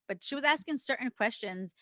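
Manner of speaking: ordinary delivery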